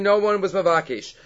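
ordinary delivery

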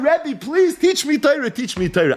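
mock sobbing plea, pitched high; tearful begging. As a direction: draw out please as if weeping. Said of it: "He starts crying".

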